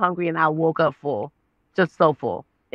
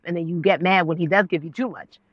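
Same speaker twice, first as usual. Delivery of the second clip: ordinary talk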